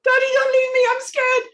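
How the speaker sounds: high, loud frightened plea; scared pleading. Acted. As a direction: beg Daddy not to go. Amy pleading.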